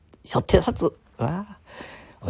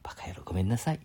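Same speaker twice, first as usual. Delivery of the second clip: whisper; 5 semitones lower, 8 dB quieter, faster than usual. Real, not acted. Apologizing in a whisper.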